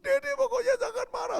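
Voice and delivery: very high, loud wail; mock bawling kid; imitated crying child. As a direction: burst into childish pleading tears. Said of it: "Sudah nangis dia".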